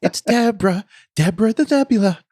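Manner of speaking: high, sing-song host announcing a cartoon; children's show voice. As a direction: present it like a kids' TV show title. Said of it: "Doesn't that sound like a, like a children's show".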